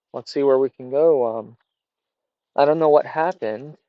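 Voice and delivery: ordinary talk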